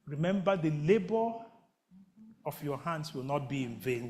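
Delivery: tender voice of the Lord. Acted. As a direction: speak as God comforting gently. Voicing the Lord softly over music.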